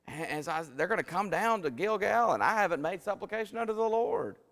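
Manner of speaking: high, loud, rushing excuses; a guilty king talking fast to shift blame; Saul pleading and blaming. Acted.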